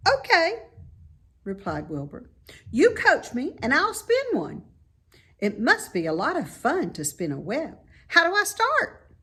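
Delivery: high, loud piggy voice; a young pig, eager and impressed; bright squeaky pig voice; Wilbur the pig. A narrator's reading.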